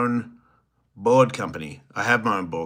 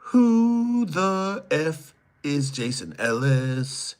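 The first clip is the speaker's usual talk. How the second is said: mock voice; 6 semitones higher, much slower than usual. Reading a comment in a voice.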